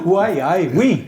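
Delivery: Geordie "why aye" turned French; jokey Geordie greeting; Geordie accent. Pretend. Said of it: "the Geordie accent".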